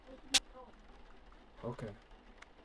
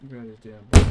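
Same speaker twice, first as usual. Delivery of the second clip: frustrated yell; 4 semitones lower, 17 dB louder than usual. Real, not acted. Yelling at game baby.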